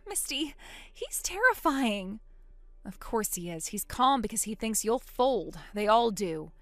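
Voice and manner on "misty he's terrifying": ordinary delivery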